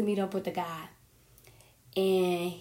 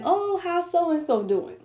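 nosy relative voice; 8 semitones higher, slower than usual. Retold or imitated. Imitating family asking about ex.

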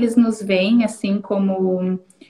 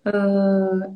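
ordinary talk, nothing marked

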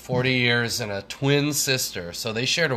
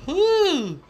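excited woo; 4 dB louder than usual. Real, not acted. Hype shout before greeting.

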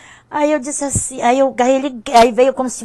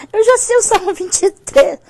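high, loud retold objection; amused mock protest; 12 semitones higher, 7 dB louder than usual. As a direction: protest loudly and high, just after a laugh. Repeats protest after laughing.

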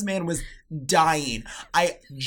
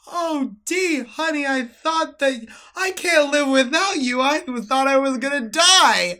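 put-on much higher, louder, slow melodramatic old man; mock dramatic grandpa; 13 semitones higher, 10 dB louder, much slower than usual. Grandpa telling his wife he feared dying, melodramatic. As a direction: wail it like a tearful old man. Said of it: "he went".

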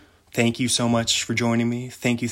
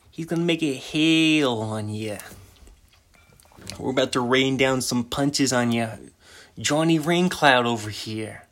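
Italian mobster; 3 semitones higher than usual. Acted. Mobster warning someone about Johnny Raincloud, mock Italian mobster accent. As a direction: do a hammed-up Italian mobster threat. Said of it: "an Italian mobster".

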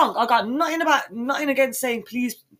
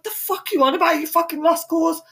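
raised, indignant comeback; mock outraged retort; 8 semitones higher than usual. Answering a rude cashier, mock outraged. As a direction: snap back at the cashier, pitched up.

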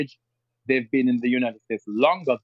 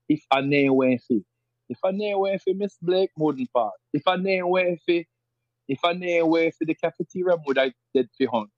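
rural Jamaican patois, pitched higher; 3 semitones higher than usual. Shown as a demonstration. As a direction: say it like someone from a country district. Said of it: "rural parts of Jamaica would say".

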